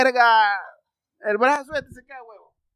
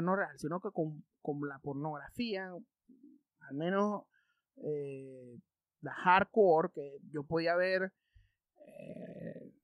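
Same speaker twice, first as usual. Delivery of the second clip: much softer and lower, half under the breath; hushed confession; 5 semitones lower, 11 dB quieter than usual. Real, not acted. Telling viewers a naughty memory, hushed voice. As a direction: drop your voice as if someone might hear.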